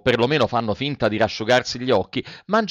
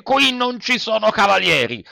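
higher, a touch louder curt reply; harsh retort; 10 semitones higher, 3 dB louder than usual. A narrator's reading. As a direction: snap back hard. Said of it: "replicò duramente".

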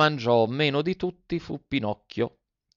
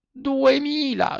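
very high, slow drawn-out duemila; cat echoing; 13 semitones higher, slower than usual. A narrator's reading. Cat marvels at the fox's sum, very high and slow. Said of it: "ripeté il gatto".

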